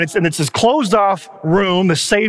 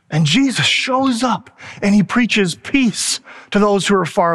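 preaching. Real, not acted. Preaching the gospel.

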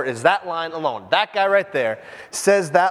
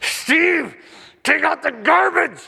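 higher mock wife command; 8 semitones higher, slower than usual. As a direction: order him like an irritated wife. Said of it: "If she says".

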